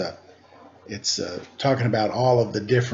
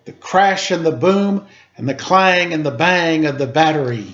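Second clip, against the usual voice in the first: bellowed; 7 semitones higher, 9 dB louder than usual. Acted. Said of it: "he bellowed".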